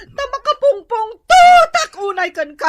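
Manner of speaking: loud furious yelling; shouting mad at her husband. Acted. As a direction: yell it in a rage at him. Wife berates husband.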